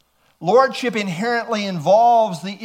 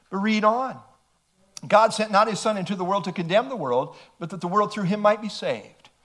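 quick recitation; faster than usual. Acted.

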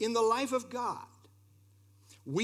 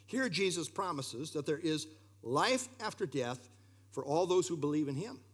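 ordinary delivery

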